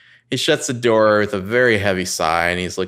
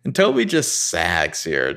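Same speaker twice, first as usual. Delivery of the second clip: usual speaking voice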